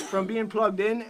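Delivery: ordinary delivery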